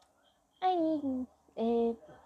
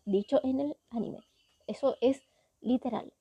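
usual speaking voice

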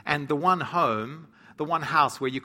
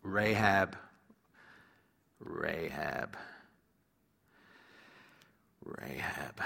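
fading repetition; 10 semitones lower, 9 dB quieter, much slower than usual. Acted. Preacher musing on the name.

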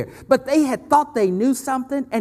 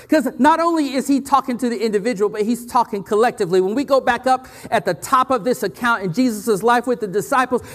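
ordinary talk

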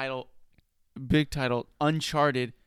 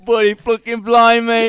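loud, higher hammed-up British accent; mock British accent; 5 semitones higher, 8 dB louder than usual. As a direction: overplay a cartoon Brit. British bit.

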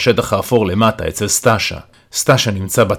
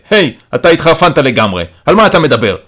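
raised, higher-pitched outburst; loud, indignant challenge; shouting; 4 semitones higher, 5 dB louder than usual. A narrator's reading. Antonio shoving Amatzia away, shouting at him. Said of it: "וצועק אליי".

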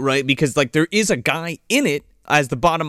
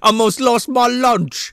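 put-on slow, higher, broad Australian accent; 7 semitones higher, slower than usual. Kano jeering at Goro, exaggerated Australian accent. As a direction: ham up the Australian accent, drawn out. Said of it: "losing my Australian accent".

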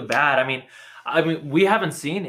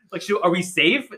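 normal talking voice

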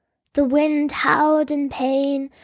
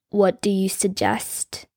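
low, slow, quietly menacing; a touch of anger under each word; venomous king; 4 semitones lower, 3 dB quieter than usual. A narrator's reading. King to his advisor, quiet restrained venom. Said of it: "laced with anger and venom".

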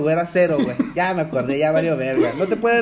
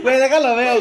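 blurting it out through laughter, louder and higher; can't stop laughing at the wrong answer; 6 semitones higher, 7 dB louder than usual. Real, not acted. Friend cracking up at his own math mistake, laughing loudly.

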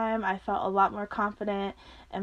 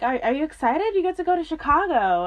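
higher, louder, eager announcement; acted excitement; 7 semitones higher, 4 dB louder than usual. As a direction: break good news with eager energy. Acts out excited news-breaking to her.

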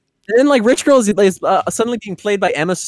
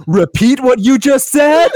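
mock outrage, higher and louder, drawn out; indignant challenge; 6 semitones higher, 5 dB louder than usual. Donnie confronts parents and evil sister over their plot, mock-indignant. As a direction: act out a loud, incredulous demand. Acted angry challenge from film.

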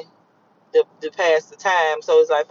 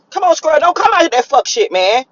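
calling to squirrel; 7 semitones higher, 4 dB louder than usual. Real, not acted.

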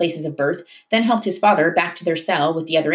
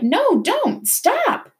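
high frantic cry to stop; begging; much slower than usual. A narrator's reading. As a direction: plead desperately for them to stop. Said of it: "Mahmoud begged".

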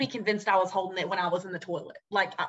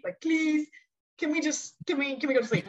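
whiny plea; 6 semitones higher than usual. Retold or imitated. Begging a frantic roommate for sleep.

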